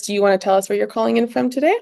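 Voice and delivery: ordinary talk, nothing marked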